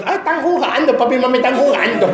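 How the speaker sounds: put-on high, light, carefree kid's voice; naive child voice. Preacher mimics children believing their parents are playing. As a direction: play the little ones who think it's a game.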